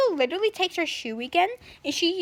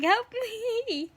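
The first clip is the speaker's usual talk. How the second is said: mock cry for help; 7 semitones higher than usual.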